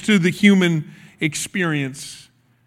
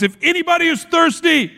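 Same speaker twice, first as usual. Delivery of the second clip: higher-pitched preacher's proclamation, mild volume; quoting a public cry, raised in pitch; loud voice; 7 semitones higher than usual. A narrator's reading. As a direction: call it out to a crowd, pitched up. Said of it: "he said in a loud voice".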